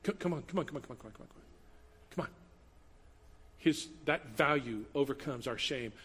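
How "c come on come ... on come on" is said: fading from soft coaxing to a whisper; a coaxing call that fades to a whisper. Acted. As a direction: gently beckon someone out of hiding, dropping to a breath. God calling Adam out of hiding.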